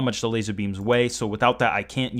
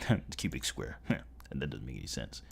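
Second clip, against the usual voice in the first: quiet breathy laugh through words; laughing at himself; 9 semitones lower, 13 dB quieter than usual. Real, not acted. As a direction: laugh off the mistake softly. Laughs at own slip.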